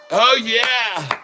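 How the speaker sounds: high, elated whoop; exultant cheer. Real, not acted. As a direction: crow it in triumph. Celebrating the stock's surge.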